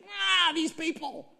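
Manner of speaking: high, hammed-up groan of exasperation; exasperated venting. Moses venting to his father-in-law, exasperated groan. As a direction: let out a mock cry of frustration.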